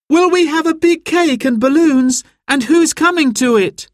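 put-on very high, thrilled little-girl voice; excited child. A narrator's reading. Girl hears about the party, bursting with excitement. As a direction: squeal the words with delight. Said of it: "jumping up and down with excitement".

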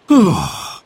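a loud, fed-up sigh; cross sigh. Acted. Harry sighing at his brother's nagging, loud cross sigh. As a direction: huff out your annoyance. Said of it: "He sighed crossly".

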